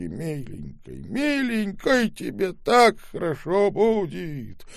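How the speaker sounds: put-on old man begging the boy, high and pleading. A narrator's reading. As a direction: beg desperately as the old man. Old man begs and bribes.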